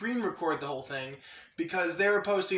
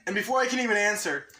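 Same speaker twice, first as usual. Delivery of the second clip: ordinary delivery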